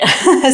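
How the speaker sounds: quick bright laugh; a little laugh at herself; light giggle; short laugh. Real, not acted. Laugh after fun read.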